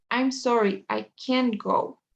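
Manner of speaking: slow, with CAN'T stressed and its t silent. Shown as a demonstration. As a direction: lean on "can't", drop the t. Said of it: "acentúo esta palabra". (énfasis en «can't», despacio y sin t)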